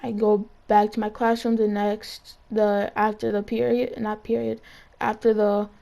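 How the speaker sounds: usual speaking voice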